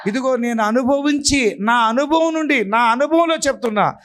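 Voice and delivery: high, climbing preacher's cry, rising with each phrase; full-force preaching. Acted. Pastor testifying to the congregation, preaching at full force. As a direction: preach it out to the back row.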